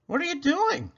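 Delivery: high astonished cry; startled shout. A narrator's reading. Mister Watson shouting at Baby in the back, astonished. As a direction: blurt it out in shock. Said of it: "shouted Mister Watson".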